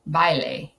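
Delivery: baile said the Spanish way, like vai-le; Spanish pronunciation of baile; Spanish word. Shown as a demonstration. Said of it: "the Spanish word for dance".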